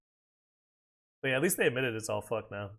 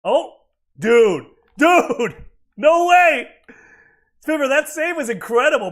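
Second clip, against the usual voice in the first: loud, high yell of disbelief; real, thrilled shouting; excited shout; 10 semitones higher, 12 dB louder, slower than usual. Real, not acted. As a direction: shout it as the save happens. Reacting to an incredible save.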